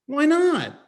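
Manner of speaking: put-on high, loud little-boy whine; child whining. Son whining at his father, high and loud. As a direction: whine it like a small kid.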